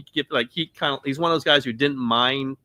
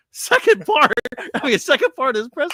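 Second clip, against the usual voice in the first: incredulous; 13 semitones higher, 6 dB louder than usual. Real, not acted. Mock incredulous echo.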